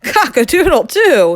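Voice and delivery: high, loud, amused crow; snickering crow. Acted. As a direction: crow it for a joke. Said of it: "he snickered".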